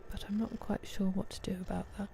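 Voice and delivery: whispered aside to herself. Real, not acted. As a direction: drop to a breathy whisper, as if to herself. Muttering to self about brightness.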